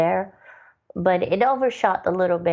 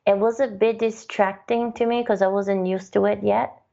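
ordinary delivery

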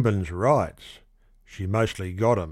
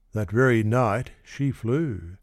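ordinary delivery, nothing marked

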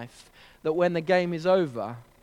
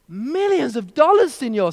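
put-on Mexican accent; 6 semitones higher, 6 dB louder than usual. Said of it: "Mexican accent".

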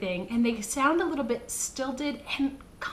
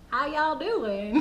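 slow Southern drawl, pitched up; Southern accent; 4 semitones higher, slower than usual. Shown as a demonstration. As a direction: stretch it out like a Southerner.

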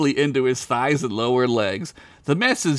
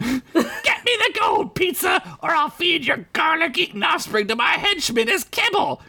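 very high threatening shout; furious Crinkles. Acted. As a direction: yell the threat at him.

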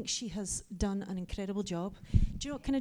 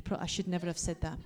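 ordinary talk

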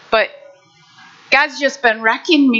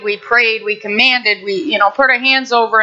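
normal talking voice

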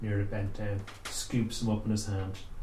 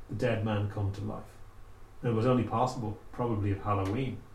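ordinary delivery, nothing marked

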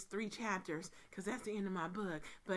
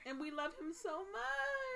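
high, drawn-out mock lament; hammed-up tearful whine; mock wail; 11 semitones higher than usual. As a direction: wail it slowly in playful grief. Mourning the owl's death together.

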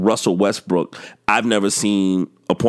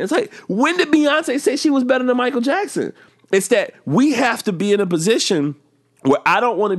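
exasperated. Real, not acted. Exasperated high outburst.

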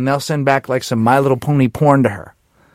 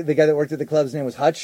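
usual speaking voice

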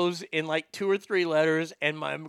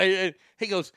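ordinary delivery

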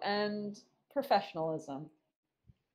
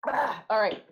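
usual speaking voice

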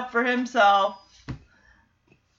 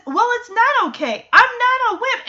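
standing up for himself; 9 semitones higher than usual. Retold or imitated. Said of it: "And Freddy says".

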